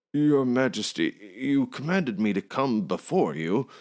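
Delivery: high, slow fawning address; bow and grovel before the king; nervous innkeeper. A narrator's reading.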